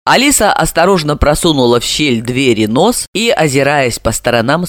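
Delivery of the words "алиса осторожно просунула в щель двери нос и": ordinary delivery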